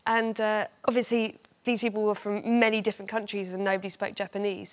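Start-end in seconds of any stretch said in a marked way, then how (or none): none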